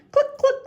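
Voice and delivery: high, loud clucking; hen clucking. A narrator's reading. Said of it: "says the hen".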